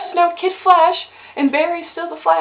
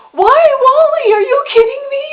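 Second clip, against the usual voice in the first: upset wail; 10 semitones higher, 8 dB louder than usual. Real, not acted. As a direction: wail it in disbelief. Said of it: "I'm just so upset about it".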